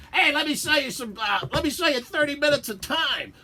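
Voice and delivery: high, loud hustling salesman voice; salesman pitch. Retold or imitated. Imitating the salesman pitching airtime, high and hustling. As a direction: sell airtime like a slick station salesman.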